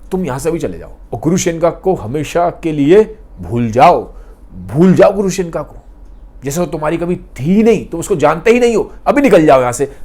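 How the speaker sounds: a man bribing a rival, loud and insistent; forceful demand. Retold or imitated. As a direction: order him out, loudly. Dimitri bribing officer forcefully.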